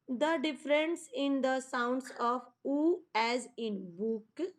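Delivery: slow, word-by-word reading of a textbook line. Shown as a demonstration. Teacher reads the page heading to students, very slow. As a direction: spell it out slowly for young learners. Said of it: "teacher reads textbook heading slowly".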